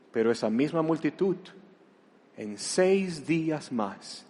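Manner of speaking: ordinary delivery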